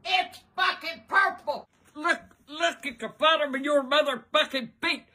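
normal talking voice

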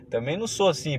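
usual speaking voice

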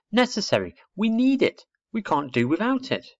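high, insistent explanation; impatient little girl. Acted. Little girl explaining a hard word to owlet, impatient. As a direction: hammer each short sentence home.

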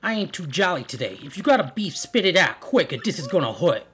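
tough wise-guy gangster, swinging pitch; funny voice. Acted. Player voicing a game tough guy, funny Italian-accented voice. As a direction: play a cartoon mobster with an Italian accent. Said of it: "I wanna do some funny voices".

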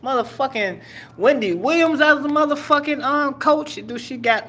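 high, put-on voice of disbelief; hammed-up shocked inner voice; mock incredulous. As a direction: squeal the question in mock shock. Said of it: "I'm like".

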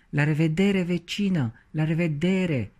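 high, strained goodbye, barely breathing; breathless farewell. A narrator's reading. Cauliflower being pulled up says goodbye, breathless. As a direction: gasp out a farewell while being choked. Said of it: "abia respirând".

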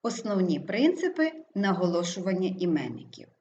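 usual speaking voice

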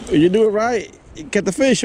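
ordinary delivery

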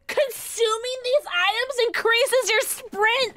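high, drawn-out excited yell; thrilled, stretched-out exclamation; excited shout. Real, not acted. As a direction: read it out in a slow, high shout. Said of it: "Sorry, I got really excited".

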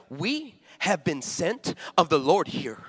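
slow, solemn holy voice; mock-spiritual pronouncement; solemn churchy friend. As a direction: intone it like a prophecy. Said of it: "She's like".

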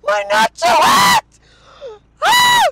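sudden high shouts of surprise; startled shout. Real, not acted. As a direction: cry out as cold water hits. Water spills on his lap.

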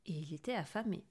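ordinary delivery, nothing marked

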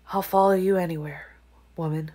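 usual speaking voice

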